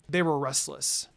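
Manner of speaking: plain reading voice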